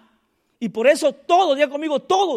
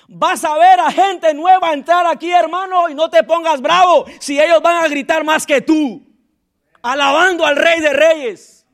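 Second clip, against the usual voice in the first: louder, driving preacher's call; full-force preaching; 7 dB louder than usual. Real, not acted. Pastor urging the church to let youth shout, fervent preaching. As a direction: preach it hard over the crowd. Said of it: "preaching at full force".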